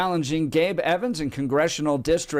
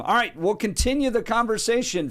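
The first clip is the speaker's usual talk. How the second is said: normal talking voice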